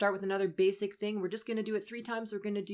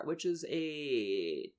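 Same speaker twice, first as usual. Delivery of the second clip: vocal fry; 5 semitones lower, much slower than usual. Shown as a demonstration. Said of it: "our creaky door".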